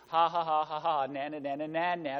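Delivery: sing-song playground jeer; mocking taunt. Habakkuk scoffs at the Babylonians, mocking taunt. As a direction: scoff with fake ha-has and na-nas. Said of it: "Habakkuk has this taunt psalm where he scoffs".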